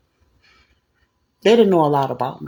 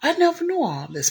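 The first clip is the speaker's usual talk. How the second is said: high-pitched mock indignation; mad, retold; 12 semitones higher, 3 dB quieter than usual. Man learns of her work for society, retold mad protest. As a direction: imitate a man protesting he was kept uninformed. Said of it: "But he mad".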